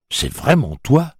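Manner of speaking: can't believe the train talks; amazed. Acted. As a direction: Bob amazed asks his train. Bob astonished.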